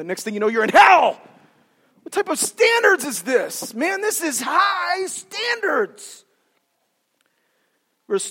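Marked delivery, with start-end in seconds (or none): 0.5-1.2 loud, jolting punchline about damnation; sudden shout. Acted. Sudden punchline after the singing. 2.0-6.2 high, slow wail of disbelief; mock dismay. Acts dismay at the strict standards.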